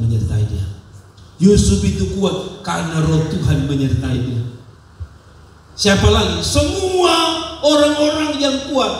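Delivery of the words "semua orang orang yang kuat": high, ringing call to the crowd; preaching crescendo. Real, not acted. Preacher to congregation, soaring high call. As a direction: lift it up and call it out to the church.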